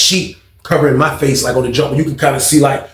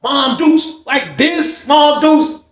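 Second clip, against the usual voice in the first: much higher, very slow amazed drawl; 11 semitones higher, much slower than usual. Real, not acted. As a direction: stretch it out in disbelief. Awed recall of the pictures.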